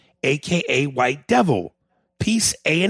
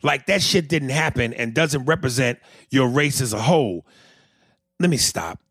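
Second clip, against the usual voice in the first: ordinary talk, nothing marked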